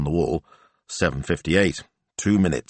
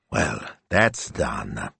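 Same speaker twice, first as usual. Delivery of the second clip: whispered with relief, lower and softer; relieved whisper; 6 semitones lower, 4 dB quieter, much slower than usual. A narrator's reading. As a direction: let it out in a hushed sigh of relief.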